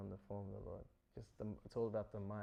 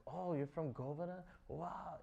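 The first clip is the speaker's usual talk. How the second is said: high-pitched delighted amazement, at usual volume; ecstatic; 6 semitones higher than usual. Retold or imitated. Lord Shiva greets the cowherd boy from Govardhan, ecstatic. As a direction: act out being thrilled to hear where someone is from. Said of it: "he becomes ecstatic".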